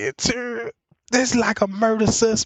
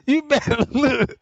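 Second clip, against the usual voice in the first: usual speaking voice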